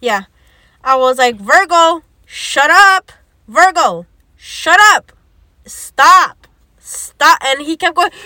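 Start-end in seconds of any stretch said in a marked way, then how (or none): 1.4-7.4 retold commands, loud and punched out; exasperated command. She tries to make Virgo shut up, exasperated. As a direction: cut him off, each command raised and separate.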